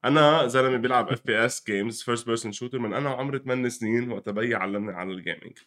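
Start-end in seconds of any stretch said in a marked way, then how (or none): none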